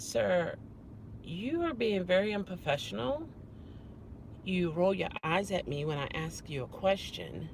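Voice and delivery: soft, measured complaint; retold restrained telling-off, voice held down; quiet reproach. Guest telling the manager he is unprofessional, quietly. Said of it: "I was talking quieter than this".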